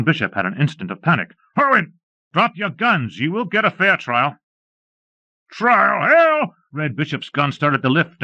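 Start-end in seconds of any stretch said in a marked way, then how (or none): 1.6-4.4 higher, commanding call to drop the guns; higher, commanding lawman voice; firm lawman order. Acted. 5.5-6.6 much higher, louder outlaw scoffing; outlaw's high, loud angry retort; defiant shout. Acted. Outlaw defies before gunfight.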